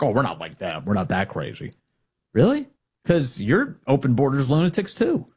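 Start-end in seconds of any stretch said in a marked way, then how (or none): 0.0-1.7 lower, softer prissy denial; mock establishment lefty. Host mocks establishment lefties, low quiet denial. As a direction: play the smug moderate, low and quiet. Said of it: "establishment lefties who are like".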